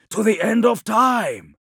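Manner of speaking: high, loud impassioned cry; fierce vow. Acted. The Khan answers Ilya's challenge to his oath, loud and fierce. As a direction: declare it at full force.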